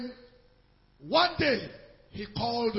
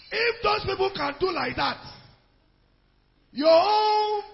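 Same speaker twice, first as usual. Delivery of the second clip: much higher and louder, exhorting the church; preaching shout; 8 semitones higher, 8 dB louder than usual. Real, not acted. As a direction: cry it out to the congregation.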